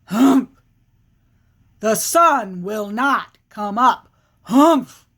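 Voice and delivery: emphatic bear chant with punched humphs; grumpy, insistent storybook bear; stubborn bear. Acted. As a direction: declare it firmly, ending in a loud huff. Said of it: "the bear is saying".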